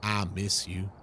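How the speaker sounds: "I" said as "ah", without a glide; Texan vowel. Shown as a demonstration. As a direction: open "ah" for "I", said low and soft. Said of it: "they say ah".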